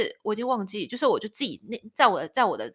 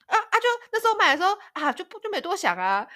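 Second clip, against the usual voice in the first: much higher, quick, flustered excuse; flustered mother-in-law; 11 semitones higher, 5 dB louder than usual. Retold or imitated. Mother-in-law explaining the wrong-sized clothes, flustered and high. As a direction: stammer it out like a caught-out, guileless mother-in-law.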